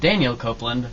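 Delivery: normal talking voice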